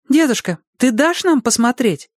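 put-on high little-child voice; asking child. A narrator's reading. Child asking grandfather for the telescope, in a high eager voice. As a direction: ask in a high-pitched child's voice.

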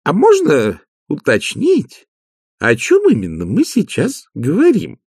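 pointedly casual question. Acted. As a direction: ask as if from pure curiosity. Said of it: "тихим голосом, тон которого подразумевал".

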